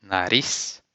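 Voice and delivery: nariz as na-REES with a tapped r; Portuguese nariz. Shown as a demonstration. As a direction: model word nariz, rising. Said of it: "Como você diz nose".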